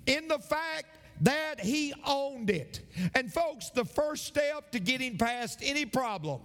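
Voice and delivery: high-pitched, driving sermon cadence; impassioned preaching. Real, not acted. Pastor urging the congregation to own their problems, impassioned preaching. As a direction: build to a high, insistent preacher's climax. Preaches in rising full force.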